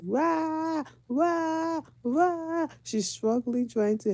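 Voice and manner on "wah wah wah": high-pitched mock wailing; drawn-out "wah" like bawling toddlers; imitated kids' screaming. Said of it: "were screaming".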